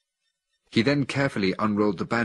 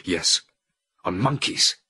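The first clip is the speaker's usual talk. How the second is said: mild retort in fury; 5 dB louder than usual. A narrator's reading. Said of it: "I retorted in fury".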